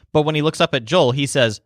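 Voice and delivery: normal talking voice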